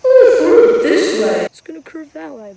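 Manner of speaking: high, very loud mock quote; hammed-up, high-pitched mimicry of mistaken people; imitating other people. Pitcher mimicking people wrong about the screwball, mock high and loud. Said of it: "people are like".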